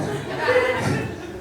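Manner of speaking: high breathy burst of laughter; high wheezing laugh. Real, not acted. Comic cracking up at his own story. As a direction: let out a squeaky wheeze.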